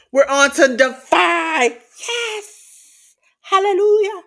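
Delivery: high-pitched, jubilant hype; excited celebration. Real, not acted. Host rejoicing at reaching the Defy show, jubilant. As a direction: whoop it up like a revival hallelujah.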